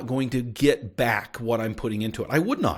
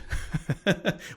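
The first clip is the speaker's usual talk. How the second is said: amused little laugh, higher pitched; short chuckle; 6 semitones higher, 5 dB quieter than usual. Real, not acted. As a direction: chuckle at your own quip. Chuckles at own college quip.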